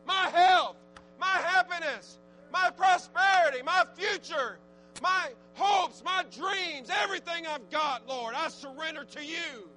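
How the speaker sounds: loud, high impassioned preaching; rising surrender litany shouted high; full-force preaching. Acted. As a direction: cry it out to the congregation.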